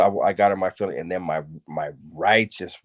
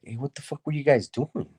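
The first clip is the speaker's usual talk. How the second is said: higher-pitched retold disbelief; baffled challenge; 7 semitones higher, 3 dB quieter than usual. Homeboys asking what he was doing, baffled. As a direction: imitate homies demanding an explanation.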